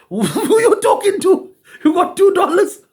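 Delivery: high mocking taunt with a laugh. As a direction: laugh at the big talker in a squeaky voice. Mocking a big talker, laughing.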